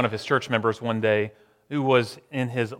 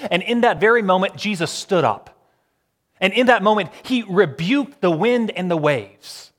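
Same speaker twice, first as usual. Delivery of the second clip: louder, higher rising sermon build; preaching climax; 6 semitones higher, 5 dB louder than usual. Acted. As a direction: lift the congregation toward the climax.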